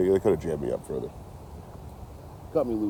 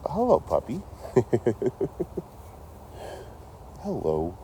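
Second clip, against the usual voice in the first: dog greeting; 6 semitones higher, 4 dB quieter, slower than usual. Real, not acted.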